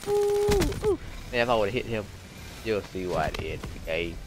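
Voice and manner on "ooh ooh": excited ooh. Real, not acted. Reacting to a near kill.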